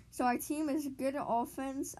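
normal talking voice

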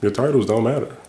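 normal talking voice

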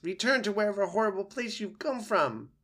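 high, loud frightened weasel; squeaking weasel. A narrator's reading. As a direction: squeak a banishment at the intruder. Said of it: "squeaked Dart as he crouched low".

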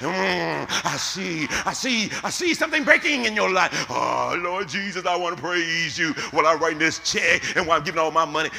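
mock false prophet. Preacher imitating the prophet reading your future, loud half-sung mockery. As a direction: croon the fake vision like a con-man seer. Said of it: "because he told you".

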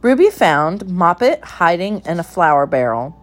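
plain reading voice

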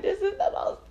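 high-pitched squeal of delight; gushing in a squeaky, delighted voice. Acted. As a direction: gush it up high like a giddy fan. Gushing over her friends.

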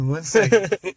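giggling repeat of the priest's stall; laughing. Acted. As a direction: crack up while repeating it. Teller cracks up repeating punchline.

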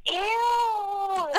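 high, loud, squealed "Ew" of disgust; child's loud disgusted shriek; disgusted kid. Retold or imitated. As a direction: squeal it like a grossed-out little girl. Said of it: "and she goes".

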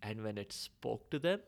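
ordinary delivery

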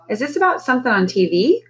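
pressing mother's question; demanding. A narrator's reading. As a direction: demand an answer. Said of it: "Clarice demanded".

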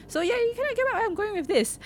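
very high-pitched, squeaky run. Real, not acted. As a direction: say it way up high in your range. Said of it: "that, that high pitch".

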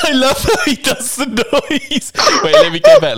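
high wheezing laugh through words; crying with laughter; helpless giggling while talking. Real, not acted.

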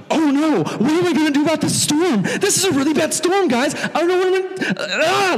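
very high, mock-panicked wail; hammed-up frantic whining; freaking out. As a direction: fake a total meltdown, rising into a cry. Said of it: "He wasn't freaking out about the storm".

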